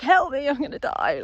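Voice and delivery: high, loud mock cry for help; hammed-up comic panic with a laugh; panicking car. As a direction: play a stalling car begging for its life. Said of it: "It's about to stall. It's like".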